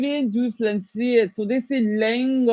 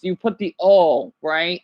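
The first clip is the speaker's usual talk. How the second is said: normal talking voice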